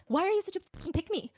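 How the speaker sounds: high exasperated jab; exasperated disbelief. Retold or imitated. Everyone reacting to Judan's remark, exasperated.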